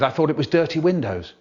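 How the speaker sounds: ordinary delivery, nothing marked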